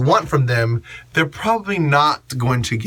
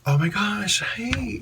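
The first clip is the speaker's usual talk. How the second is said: quiet gush; 7 semitones higher, 6 dB quieter than usual. Shown as a demonstration. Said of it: "You can gush quietly".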